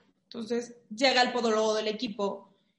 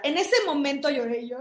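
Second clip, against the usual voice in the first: ordinary delivery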